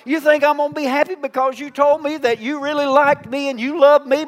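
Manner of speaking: usual speaking voice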